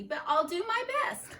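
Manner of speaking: usual speaking voice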